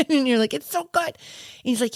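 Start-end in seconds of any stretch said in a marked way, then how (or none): 0.5-1.1 put-on high, childish delight; toddler voice. Runner retells savoring Jell-O like a little kid, high toddler voice. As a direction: coo it like a happy toddler. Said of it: "you're, like, two years old now".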